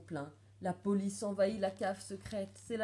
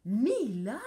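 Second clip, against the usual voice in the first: loud stunned shout; cry of astonishment; 3 semitones higher, 10 dB louder than usual. A narrator's reading. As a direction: cry out the name in shock. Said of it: "pousser un cri de stupeur". (cri de stupeur)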